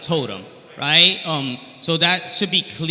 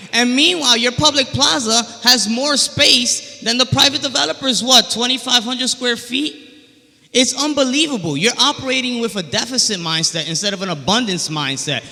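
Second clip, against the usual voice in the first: rally the room, voice raised high; fiery speech; 6 semitones higher than usual. Real, not acted. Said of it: "You set the room on fire".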